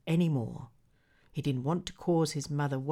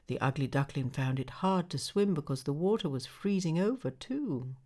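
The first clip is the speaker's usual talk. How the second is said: ordinary delivery, nothing marked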